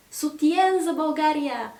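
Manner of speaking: high, hammed-up French-style reading; comic reading. Colleague reading the French president's statement, lightly hammed up. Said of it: "reading comically".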